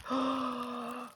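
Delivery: soft sharp intake of breath; awed gasp. Real, not acted. Seeing the sparkle dots, a delighted gasp. Unrolling the pretty material.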